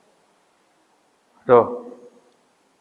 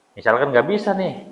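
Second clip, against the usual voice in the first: normal talking voice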